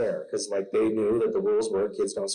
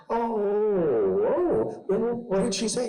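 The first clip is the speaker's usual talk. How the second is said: mock parental concern; 9 semitones higher than usual. Dad questions tattling daughter, exaggerated concern. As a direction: play up a dad's worried reaction.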